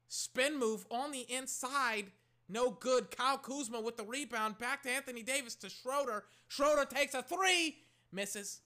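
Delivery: high, loud broadcaster call; excited play-by-play. Real, not acted. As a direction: call it live like a commentator.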